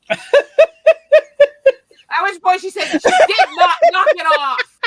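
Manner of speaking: high, loud words through laughter; can't stop laughing mid-sentence. Real, not acted. Laughing while telling the punchline.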